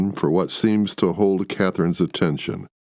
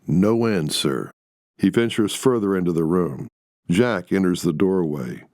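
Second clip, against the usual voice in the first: ordinary delivery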